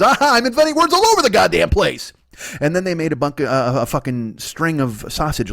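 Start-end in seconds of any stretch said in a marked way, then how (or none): 0.0-2.1 high, loud triumphant crowing; inventor's exclamation, high and loud; proud caveman boast. Acted. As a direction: crow about it like a proud inventor.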